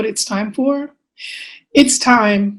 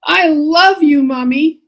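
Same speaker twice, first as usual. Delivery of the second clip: put-on high, lilting little-kid voice; affectionate child; 6 semitones higher, 4 dB louder, slower than usual. Child to mother at tuck-in, affectionate high voice. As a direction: say it like a small child at goodnight.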